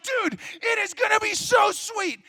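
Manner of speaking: put-on big brother talking the nervous kid into the ride, pumped up; hyped-up persuading. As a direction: sell the dumb idea with big excitement. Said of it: "And so I'm like".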